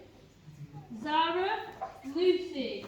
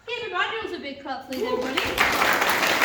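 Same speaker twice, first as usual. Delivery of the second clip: calling out; 4 semitones higher, 9 dB louder than usual. Real, not acted. Calling the crowd to clap.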